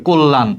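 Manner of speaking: কল্যাণ said slowly with the first vowel as o, kol-lan; slow model reading of কল্যাণ, first syllable like ko; teacher models কল্যাণ with an o sound; pronounced kollan. Shown as a demonstration. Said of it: "এই কল্যাণটা ওকারের মতো উচ্চারণ হবে".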